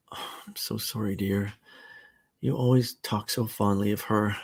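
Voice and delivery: soft, consoling; tender sympathy. Acted. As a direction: comfort him gently. Sarah consoles Robert.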